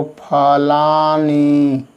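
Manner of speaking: drawn-out dictation, every syllable held; slow dictation. Shown as a demonstration. Teacher dictating the answer to pupils, very slowly. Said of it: "इसको यहाँ लिखना है आप लोगों को".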